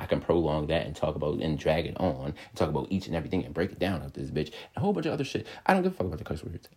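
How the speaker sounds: ordinary delivery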